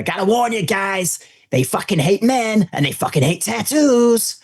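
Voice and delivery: high, slow-building put-on warning; animated American guide. Eddie warns tattooed visitors about the nuns, animated high imitation. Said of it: "very animated".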